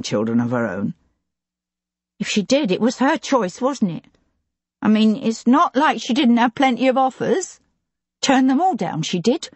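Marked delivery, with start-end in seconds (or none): 2.2-9.5 a hint of a chatty older servant, higher; gossipy housekeeper voice, pitched up; lightly voiced housekeeper. A narrator's reading. Housekeeper chatting with the lord, in a higher gossipy voice.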